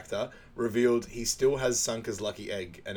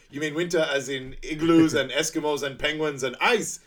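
raised, louder incredulous list; mock disbelief; 5 semitones higher, 5 dB louder than usual. Quoting Sanka on what winter means, high incredulous question. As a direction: pile up the examples in disbelief.

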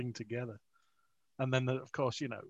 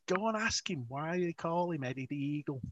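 higher-pitched imitated Scouse driver; thick Scouse accent; 4 semitones higher than usual. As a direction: egg someone on in Scouse. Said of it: "spoke with this really thick Scouse accent".

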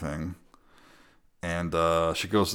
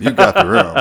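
loud, laughing high-pitched agreement; 12 semitones higher, 10 dB louder than usual. Real, not acted. Laughing at the situation.

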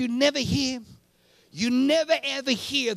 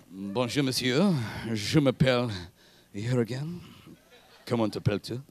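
French; 12 semitones lower than usual. Shown as a demonstration. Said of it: "Which is French".

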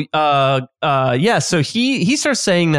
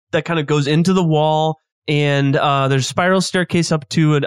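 ordinary talk

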